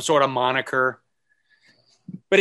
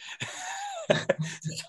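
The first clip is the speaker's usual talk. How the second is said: high squeaky laugh; 9 dB quieter than usual. Real, not acted.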